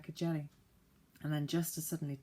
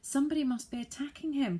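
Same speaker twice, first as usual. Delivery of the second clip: higher, urgent alarm; alarmed cry; 9 semitones higher, 4 dB louder, faster than usual. A narrator's reading. Joe hearing the saucepan man yell, crying out higher in alarm. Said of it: "cried Joe".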